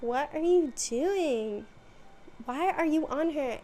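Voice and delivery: imitating a scandalized protest; mock indignation. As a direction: stretch it out in mock disbelief. Acted challenge after being tackled.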